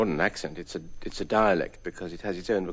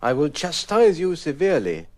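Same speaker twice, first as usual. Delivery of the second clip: higher, louder prim menace; posh threat; 9 semitones higher, 5 dB louder than usual. Acted. Gentleman warning a rival, prim and grand.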